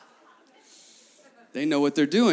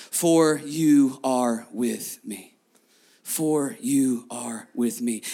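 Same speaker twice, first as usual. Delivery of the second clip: slow, tender verse; 6 semitones lower, much slower than usual. Acted.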